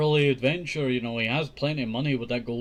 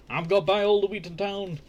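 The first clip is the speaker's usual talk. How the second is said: repeated line pitched higher in a mock greedy mother voice; 6 semitones higher, faster than usual. Greedy mother gloating over gold, repeated higher in mock voice. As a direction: say it again, higher and more gleeful. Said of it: "his mother's just saying".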